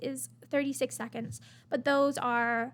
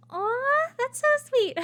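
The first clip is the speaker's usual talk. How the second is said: high, heartfelt aww; real gushing at a sweet gesture; touched cooing; 10 semitones higher, 5 dB louder, slower than usual. Real, not acted. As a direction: coo out loud, genuinely touched. Touched by a kind message.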